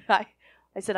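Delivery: ordinary talk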